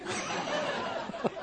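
soft airy laugh; airy giggle; breathy laugh. Real, not acted. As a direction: laugh quietly at the joke. Laughs at own joke.

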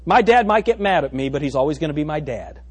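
usual speaking voice

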